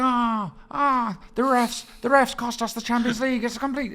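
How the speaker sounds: high, whiny fan voice; mock whining fan. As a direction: whine like a moaning fan. Mocking fans blaming the refs.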